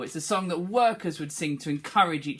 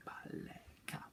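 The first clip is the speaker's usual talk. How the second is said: whispered Baleka; whispered echo; 24 dB quieter than usual. Shown as a demonstration. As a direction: whisper the reply. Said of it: "pupil echo".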